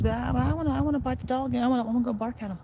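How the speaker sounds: high squeaky dog voice, begging to chase; the dog's voice. Pretend. Owner voicing his dog who wants to bark at another dog, high and eager. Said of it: "She says".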